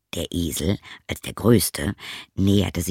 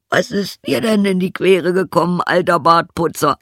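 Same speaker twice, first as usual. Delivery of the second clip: high, loud donkey voice; donkey character; 10 semitones higher, 6 dB louder than usual. Acted. Donkey asks the gloomy cat what's wrong, high donkey voice. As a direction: call the question out high.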